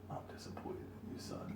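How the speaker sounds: whispered, disappointed father; hushed dad. Retold or imitated. Retelling his dad's words to friends in a whisper. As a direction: breathe it out like a let-down dad. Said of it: "the first time that my dad".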